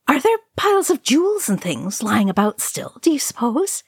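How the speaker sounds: high, lilting, eager curiosity. A narrator's reading. Merry asking about treasure in Moria, eager. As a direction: ask with pitch bouncing up in anticipation. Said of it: "Merry said eagerly".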